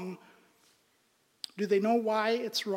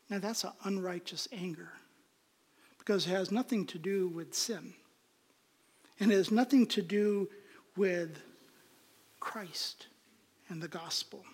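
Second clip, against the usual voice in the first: normal talking voice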